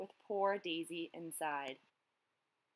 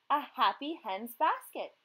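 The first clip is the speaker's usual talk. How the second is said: repeated loudly; 4 semitones higher, 5 dB louder than usual. A narrator's reading. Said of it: "he repeated".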